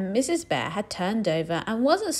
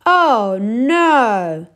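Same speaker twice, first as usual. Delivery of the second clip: higher, louder fed-up groan; weary groan; 4 semitones higher, 9 dB louder than usual. Acted. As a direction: groan like a worn-out bear. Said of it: "said Mister Bear".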